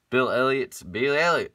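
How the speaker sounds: loud, high mock-excited shout; hammed-up name call. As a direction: holler the driver's name like a running gag. Hammed-up meme shout of driver's name.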